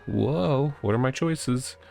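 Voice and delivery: ordinary talk, nothing marked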